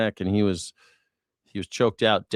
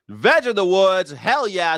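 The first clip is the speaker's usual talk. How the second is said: hyped shout-out; 8 semitones higher, 4 dB louder, slower than usual. Real, not acted. Hyping a donor's name.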